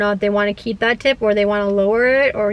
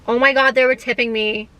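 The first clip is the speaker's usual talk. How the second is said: ordinary talk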